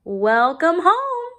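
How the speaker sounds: high, loud, slow greeting; cheerful mother. A narrator's reading. Mother welcoming her daughter home, cheerful. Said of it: "said her mother".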